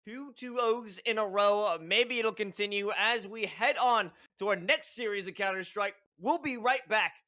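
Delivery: loud, upbeat broadcast sign-off; esports announcer. Acted. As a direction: hype it up like a caster. Caster signs off to break.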